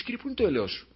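a small plaintive cry to the Lord; pleading sinner. Retold or imitated. A believer who erred begs the Lord for mercy, high and soft. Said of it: "Θα πεις".

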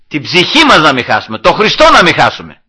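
loud, insistent preacher's answer; emphatic retort. Real, not acted. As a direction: drive it home loudly. Preacher's forceful answer to objectors. (εμφατική, δυνατή απάντηση κηρύγματος)

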